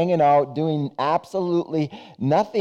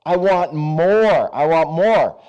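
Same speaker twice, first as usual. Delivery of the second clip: slow, louder greedy want; preacher voicing a greedy man's hunger; greedy craving; 3 dB louder, slower than usual. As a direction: lean into the craving for more.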